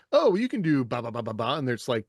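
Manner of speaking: ordinary delivery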